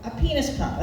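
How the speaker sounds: ordinary talk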